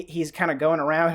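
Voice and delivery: ordinary talk, nothing marked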